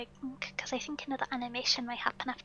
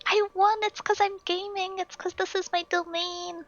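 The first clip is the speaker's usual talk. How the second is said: shrieking jackpot winner; 6 semitones higher, 6 dB louder than usual. Real, not acted. Wins the puzzle, gloats playfully.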